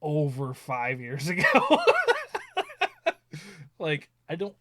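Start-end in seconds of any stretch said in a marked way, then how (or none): 1.5-3.7 big high laugh; loud high laughter. Real, not acted. Dad cracking up about the old story, loud high laugh. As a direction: break up laughing hard.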